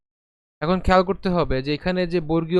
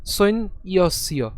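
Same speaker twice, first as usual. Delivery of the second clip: সৈন ইয়স্য very slow, soin-iosio; slow syllabic সৈন ইয়স্য; slow and separated; 2 semitones higher, much slower than usual. Shown as a demonstration. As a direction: stretch সৈন ইয়স্য out slowly. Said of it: "একসাথে যদি উচ্চারণ করি".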